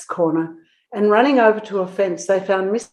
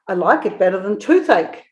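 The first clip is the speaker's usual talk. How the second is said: plain reading voice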